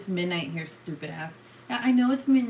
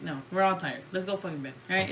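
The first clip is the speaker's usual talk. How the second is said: ordinary talk, nothing marked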